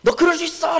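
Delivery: high-pitched mock yell at someone; yelling at someone in a quarrel. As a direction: act out a scolding outburst. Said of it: "막 소리 지르는 거".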